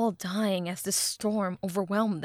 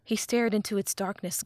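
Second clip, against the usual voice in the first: ordinary delivery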